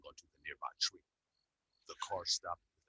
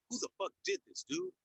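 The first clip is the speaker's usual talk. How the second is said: angry yell. A narrator's reading. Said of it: "Yelled in anger".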